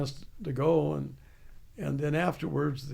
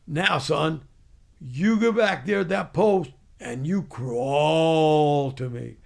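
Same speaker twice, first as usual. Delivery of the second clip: retold Southern drill sergeant shouting; sergeant's command; 5 dB louder, slower than usual. Drill sergeant orders him to crawl.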